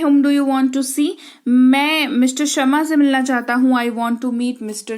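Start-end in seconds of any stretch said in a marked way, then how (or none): 0.0-1.1 slowly, every word given its space; slow model question. Shown as a demonstration. Teacher models the question slowly to learners. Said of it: "आप किससे मिलना चाहते हैं?".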